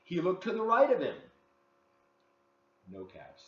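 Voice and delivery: put-on lively read-aloud for children; story narration. A narrator's reading. Host reading a picture book to kids, lively storytelling.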